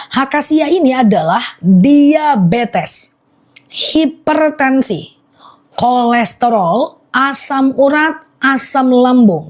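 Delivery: slow and deliberate, word by word; slowly repeated. Shown as a demonstration. Live seller repeating the list for viewers, slowly. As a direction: spell out each ailment slowly for the viewers. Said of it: "Aku ulang pelan-pelan".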